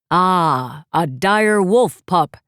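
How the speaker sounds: measured, booming bear professor; Professor Papa Bear. Acted. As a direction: present each pet slowly like a kindly teacher bear. Professor Papa Bear names pet.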